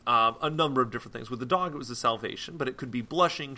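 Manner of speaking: normal talking voice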